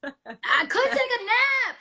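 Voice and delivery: high, loud, drawn-out and laughing; playfully exaggerated, slow high exclamation; mock temptation. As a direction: stretch it out like a gleeful revelation. Playfully acting out tempting nap idea.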